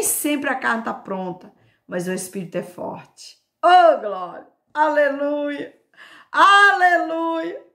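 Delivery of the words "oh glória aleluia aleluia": loud, drawn-out hallelujahs; exultant praise. Real, not acted. Preacher praising God before the reading, exultant. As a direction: cry out praise, stretching each word.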